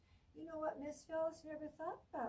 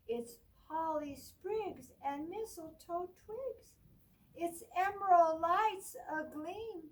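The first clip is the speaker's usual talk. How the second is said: bright, lively storybook read, higher and louder; reading to children; 6 semitones higher, 10 dB louder, slower than usual. Acted. Teacher reading a Christmas book to children, lively storybook voice. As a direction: read it out like a picture book for a class.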